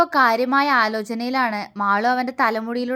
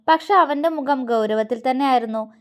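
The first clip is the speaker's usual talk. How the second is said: plain reading voice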